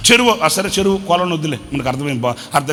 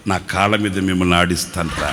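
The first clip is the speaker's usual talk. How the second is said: God's tender promise; 12 semitones lower, 3 dB quieter than usual. Acted. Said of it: "నా దేవుడు అంటాడు".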